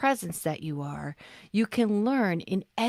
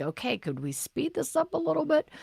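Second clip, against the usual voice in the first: mock impatience; 8 semitones higher than usual. Impatient behind slow walker.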